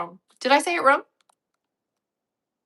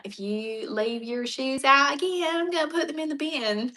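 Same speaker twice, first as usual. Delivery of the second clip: put-on hillbilly accent; 5 semitones higher than usual. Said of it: "it gets real hillbilly".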